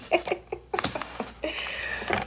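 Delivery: high, airless wheezing laugh; real wheeze of laughter; breathless laugh. Real, not acted. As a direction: laugh without voice. Laughing at card message.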